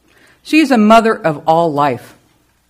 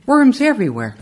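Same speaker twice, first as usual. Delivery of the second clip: normal talking voice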